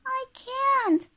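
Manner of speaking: very high little voice; Mister Small. Acted. Small character answers Miss Bossy, high-voiced. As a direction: answer in a tiny high-pitched voice. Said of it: "said Mister Small".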